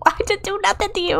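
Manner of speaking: high, fast, soft innocent protest; mock indignant protest. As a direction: play the wronged innocent in a rush. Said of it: "And I'm like".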